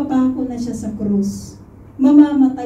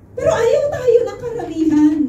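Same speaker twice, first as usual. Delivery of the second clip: tearful lament; 7 semitones higher than usual. Real, not acted. Preacher laments rejection by many.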